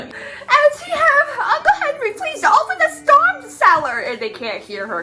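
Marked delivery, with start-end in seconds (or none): 0.0-4.0 Dorothy voice. Retold or imitated.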